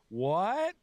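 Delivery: high, rising mock "What?"; deaf drunk woman. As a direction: imitate a drunk who missed every word. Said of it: "a deaf, drunk Filipino lady".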